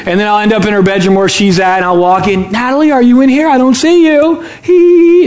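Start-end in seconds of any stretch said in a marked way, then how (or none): 2.5-4.4 higher, teasing singsong call; playful seeking dad. Retold or imitated. Dad entering hiding daughter's bedroom, playfully calling. Father pretends he can't find daughter. 4.6-5.2 high, loud imitated child giggle; louder giggle. Daughter giggling as dad gets close, louder. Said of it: "And the giggle's much louder".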